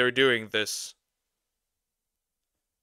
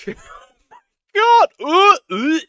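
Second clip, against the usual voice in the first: laughing shout; 9 dB louder, much slower than usual. Real, not acted.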